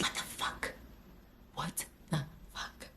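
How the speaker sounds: barely audible, stunned whisper; bewildered whisper. Retold or imitated. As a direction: whisper it to yourself in disbelief. Genuinely confused, replaying her racing thoughts.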